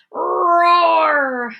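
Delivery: high, loud roaring bellow; mock roar. Bruce roaring at the mice, loud roar. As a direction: let the roar burst out. Bear loses his cool.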